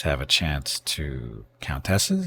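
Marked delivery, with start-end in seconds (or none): 1.6-2.3 "countesses" with a full "tess" in the middle, questioning; trying alternative pronunciation. Shown as a demonstration. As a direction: say "countesses" as coun-TESS-es, unsure and rising. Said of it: "Is it countesses or countesses?".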